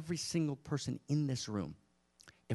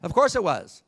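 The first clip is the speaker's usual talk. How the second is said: louder and higher, hammering the point; a much louder, higher repeat; emphatic; 5 semitones higher, 11 dB louder than usual. Real, not acted. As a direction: say it again with force.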